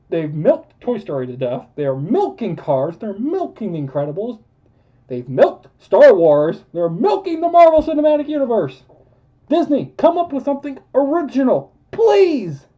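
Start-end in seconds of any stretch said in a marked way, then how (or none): 5.1-12.7 exasperated rant. Real, not acted. Ranting about sequels.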